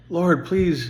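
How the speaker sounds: quick mock plea to the Lord; mock pleading prayer. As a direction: plead like a sinner who'll do it again anyway. Mocking hollow repentance prayer.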